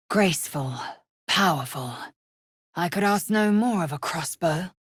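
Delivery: ordinary delivery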